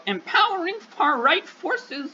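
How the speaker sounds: high, slow silly clown voice. Pretend. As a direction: drag it out like a clown. Clown voice for his claim.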